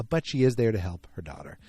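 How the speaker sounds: ordinary delivery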